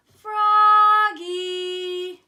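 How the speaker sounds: loud, high singsong call; mother calling. A narrator's reading. Mother calling her son Froggy, loud singsong holler. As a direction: holler it long and high through the house. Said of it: "called his mother".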